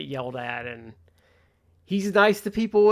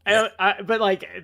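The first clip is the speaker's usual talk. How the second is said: ordinary delivery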